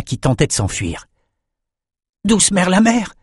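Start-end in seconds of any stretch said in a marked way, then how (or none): 2.2-3.1 high startled cry; exclaiming. A narrator's reading. Bannon seeing the sea serpent, startled cry. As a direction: blurt it out in astonishment. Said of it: "s'écria Bannon".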